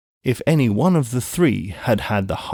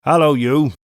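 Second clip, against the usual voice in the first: louder, higher gruff hail; calling out; 4 semitones higher, 6 dB louder than usual. A narrator's reading. Guard hailing the rider, calling out. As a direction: hail the stranger.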